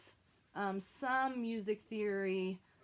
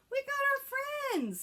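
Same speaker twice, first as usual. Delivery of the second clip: excited puppet intro; 4 dB louder, slower than usual. Real, not acted. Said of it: "introducing puppets".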